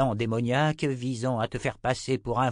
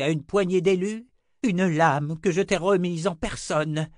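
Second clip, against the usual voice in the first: angry scolding; 7 semitones higher, 4 dB louder than usual. Acted. Zed berates grandson.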